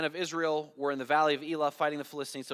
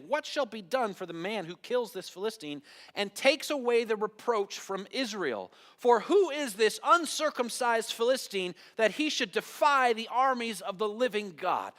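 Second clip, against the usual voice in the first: higher, emphatic reading of a challenge; indignant challenge; 6 semitones higher, 4 dB louder than usual. A narrator's reading. David to the men who stood by him, indignant challenge. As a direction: read it out as a bold demand.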